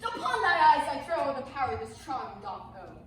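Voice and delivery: loud, contemptuous charm; scornful curse. Acted. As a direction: fling the curse at him. Puck cursing Demetrius.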